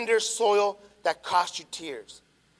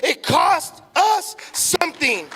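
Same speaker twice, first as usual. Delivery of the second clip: slow, higher, louder shouted declaration; preacher's shout; 8 semitones higher, 6 dB louder, much slower than usual. Acted. Pastor driving the point home to the church, shouting. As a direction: bellow the point to the back row.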